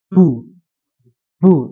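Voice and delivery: Bu said with a heavy puff of breath, wrong; bu with too much breath. Shown as a demonstration. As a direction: push lots of air out on Bu. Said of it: "Terlalu banyak, apa namanya, hembusan". (Bu dengan hembusan napas berlebihan)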